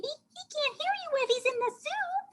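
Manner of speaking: very high chirpy bird; squeaky storybook bird; bird character. A narrator's reading. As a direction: tweet it in a tiny falsetto.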